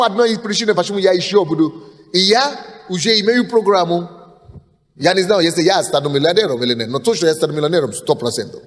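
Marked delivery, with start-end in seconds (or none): none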